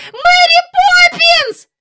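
very high, loud, drawn-out scream; long wailing child's cry; screamed call. Acted. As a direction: scream the name desperately for help. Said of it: "закричала вона знову".

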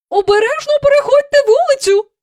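very high, loud call; mother calls to the children leaving. Acted. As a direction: call after them across the yard. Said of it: "гукнула вона".

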